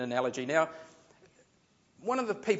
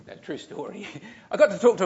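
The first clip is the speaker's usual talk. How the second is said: chuckling through the words, then louder; laughing; 4 semitones higher, 5 dB louder than usual. Real, not acted. Pastor amused at slipping off to the movies, laughing.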